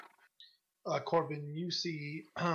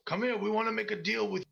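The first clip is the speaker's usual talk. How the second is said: goblin yell; 6 semitones higher, 3 dB louder, faster than usual. Acted. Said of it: "the same voice yells out again".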